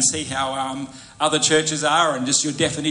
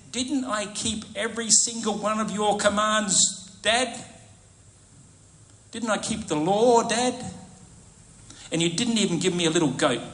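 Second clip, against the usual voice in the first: put-on aggrieved older son; 5 semitones higher than usual. Older son complaining to father.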